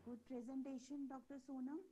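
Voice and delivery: faint, far-off voice on a weak line; barely audible. Real, not acted. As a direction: speak from far away, barely heard. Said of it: "your voice is very low".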